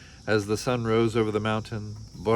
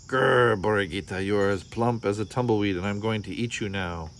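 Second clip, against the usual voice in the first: plain reading voice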